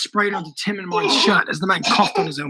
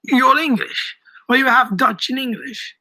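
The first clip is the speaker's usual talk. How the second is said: higher, surprised questioning; wondering; 6 semitones higher than usual. A narrator's reading. Old shopkeeper wonders at Daniel's English, surprised. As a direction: ask in raised-pitch surprise. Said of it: "the man wondered".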